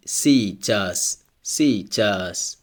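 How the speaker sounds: "сейчас" said si-CHAS; unstressed E as I. Shown as a demonstration. Teacher models an example word for learners, "сейчас" as si-CHAS. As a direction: say "сейчас" with ee first, stress on the end. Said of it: "so it's pronounced like E".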